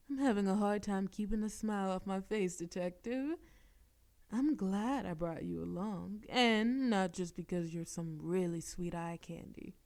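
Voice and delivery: normal talking voice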